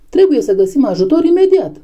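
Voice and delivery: high, pressing plea; urgent alarm. A narrator's reading. Clover calling for help for fallen Boxer, urgent. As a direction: raise the pitch and demand help at once.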